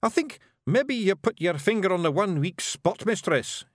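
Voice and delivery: high Scots Feegle, sheepish; admitting Feegle. A narrator's reading. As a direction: Rob admits to Miss Level, high Feegle. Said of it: "he admitted".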